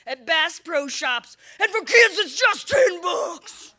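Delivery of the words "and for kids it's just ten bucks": monster truck ad. Acted. Ad pitch for kids price.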